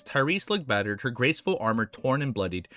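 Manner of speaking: plain reading voice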